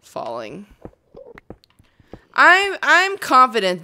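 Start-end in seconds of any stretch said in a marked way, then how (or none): 2.3-3.8 mock confident. Mock boast about beating Enderman.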